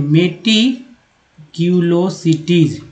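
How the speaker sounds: each syllable of मेटिक्यूलोसिटीज drawn out very slowly; slow word demo. Shown as a demonstration. Teacher sounding out a new word to students, very slowly. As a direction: spell out मेटिक्यूलोसिटीज slowly for learners. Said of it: "चलिए एक और देखते हैं".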